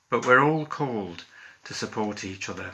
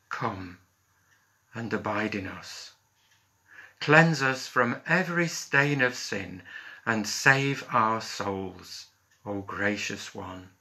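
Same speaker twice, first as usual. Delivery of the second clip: measured prayer voice, each phrase set apart and dropping away; led prayer. Real, not acted. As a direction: lead the congregation in prayer, phrase by phrase. Minister leads an opening prayer.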